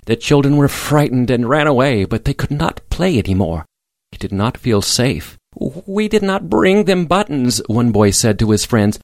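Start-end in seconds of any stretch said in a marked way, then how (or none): 5.9-7.6 put-on worried boy. A narrator's reading. Scared boy talks to friends.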